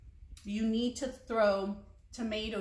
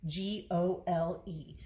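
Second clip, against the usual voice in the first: slow, spelling each letter; spelled out letter by letter; slower than usual. Shown as a demonstration. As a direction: spell the name slowly. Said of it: "spelling out the soup name".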